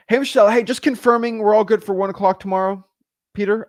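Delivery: peppy, friendly caller; upbeat phone greeting. Acted. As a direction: open the confirmation call brightly. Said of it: "let's say I'm calling".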